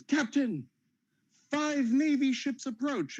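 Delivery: high, slow lookout cry; sailor's alarmed high call; crow's nest lookout. Retold or imitated. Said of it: "he goes".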